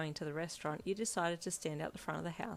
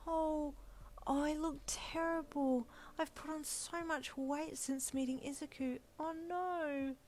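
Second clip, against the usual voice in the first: put-on higher, dismayed young woman's voice; fretting at her reflection in the mirror; heroine voice; 10 semitones higher, slower than usual. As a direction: mirror self-pity, a lighter pitched lament.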